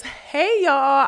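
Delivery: cheerful hey; bright greeting. Real, not acted. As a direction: call out hello to viewers. Greeting the audience.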